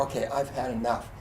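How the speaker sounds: low, soft resignation; subdued retold surrender; acted weariness. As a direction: give up like a spent runner. Retold tired runner giving up.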